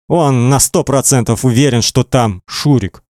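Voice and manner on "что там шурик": ordinary delivery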